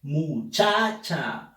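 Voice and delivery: muchacha stretched slowly, syllable by syllable; slow syllabified. Shown as a demonstration. Teacher sounding out muchacha slowly for the class. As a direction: draw out "Muchacha" mu-cha-cha slowly. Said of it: "Siguiente, muchacha".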